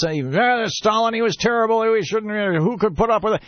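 high-pitched hammed-up complaint; Khrushchev criticizing. Khrushchev denouncing Stalin before the Politburo, mock indignant. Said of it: "he's criticizing Stalin. And he says".

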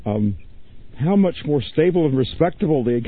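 ordinary talk, nothing marked

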